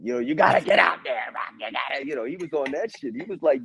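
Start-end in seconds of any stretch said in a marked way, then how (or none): 0.2-2.1 imitating a trainer firing up Rocky; a light mock coach pushing his fighter back out; Rocky pep talk. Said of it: "it was a Rocky moment".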